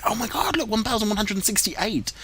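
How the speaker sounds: high amazed exclaim; thrilled by the sudden jump; excited. Real, not acted. Ridership suddenly jumps.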